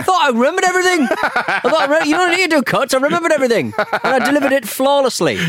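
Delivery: squeaky, fast, high-pitched boast; hammed-up shrill self-congratulation; bad acting. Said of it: "I was like".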